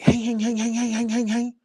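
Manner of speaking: high, ringing repeated monotone; imitated bling noise. Comic showing how her diamonds were blinging, high mimicked chime.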